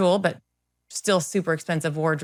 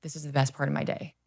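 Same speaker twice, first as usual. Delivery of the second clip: put-on quiet quoted old man; 4 semitones lower, 9 dB quieter, faster than usual. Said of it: "he was just like".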